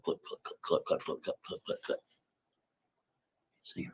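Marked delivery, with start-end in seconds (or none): 0.0-2.0 whispered click click of a rock rolling down; imitated clicking. Rock clattering down a hole, voiced as soft clicks. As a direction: tick it out softly like a falling stone. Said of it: "You can hear it click".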